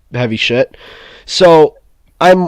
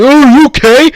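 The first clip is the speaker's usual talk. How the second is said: much higher, louder mocking imitation; mock kid voice; 11 semitones higher, 7 dB louder than usual. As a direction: parody the kid's careless question. Mocking the kid after the fall.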